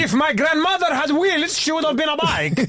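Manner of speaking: put-on very high quavering old woman; high mock old woman; old granny proverb voice. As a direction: croak out folk wisdom like a granny.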